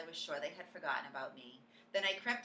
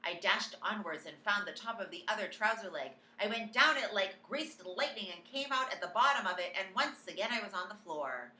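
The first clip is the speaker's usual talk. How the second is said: ordinary delivery, nothing marked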